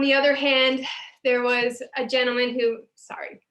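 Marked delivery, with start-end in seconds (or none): 2.9-3.4 whispered sorry. Real, not acted. Whispered aside.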